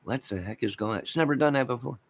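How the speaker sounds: usual speaking voice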